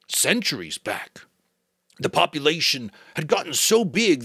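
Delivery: the giver, grave. Acted. Giver figure speaks.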